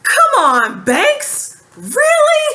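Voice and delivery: high, rising come-on-really; rising incredulous exasperation; exasperated disbelief. Real, not acted. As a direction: plead with Banks in disbelief. Exasperated at Banks.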